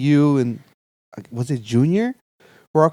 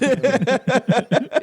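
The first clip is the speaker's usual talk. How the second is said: laugh; 9 semitones higher than usual. Real, not acted. Laugh after the story.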